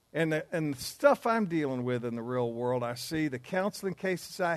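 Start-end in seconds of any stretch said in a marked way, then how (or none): none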